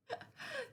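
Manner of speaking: high, soft, crying laugh; a teary little laugh; tearful laugh. Real, not acted. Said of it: "I'm getting misty-eyed".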